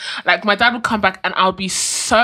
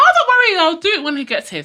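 mock whiny child; 11 semitones higher, 4 dB louder, faster than usual. Child stalling her mom.

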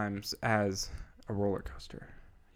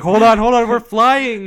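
much higher and louder hammed-up panic; mock panic; 11 semitones higher, 13 dB louder, slower than usual. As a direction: squeal it like the plane's falling.

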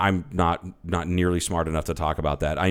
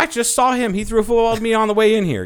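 high, louder silly joking; playful joke; 13 semitones higher, 5 dB louder than usual. Pretend. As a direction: toss out the absurd claim, high and playful. Absurd joke, pitch way up.

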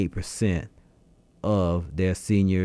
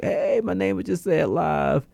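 higher-pitched, thrilled listener reaction; excited listener; 10 semitones higher than usual. Acted. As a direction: act out a fan hearing their name on air. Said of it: "you hear like".

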